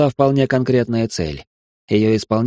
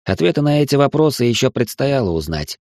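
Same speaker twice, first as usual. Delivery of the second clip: ordinary delivery, nothing marked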